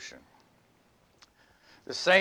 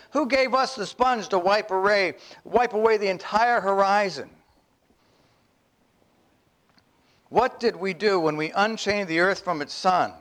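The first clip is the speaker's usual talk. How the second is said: raised, urgent madman's lament; madman proclaiming; 6 semitones higher than usual. Acted. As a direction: accuse everyone, you and I. Madman's speech continues.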